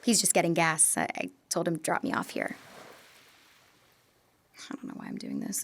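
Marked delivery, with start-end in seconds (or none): 4.7-5.6 hushed self-doubting whisper; a whispered confession of doubt; whispered aside. Acted. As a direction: breathe it to yourself, almost unheard. Self-doubting aside mid-message.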